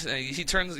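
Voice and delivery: normal talking voice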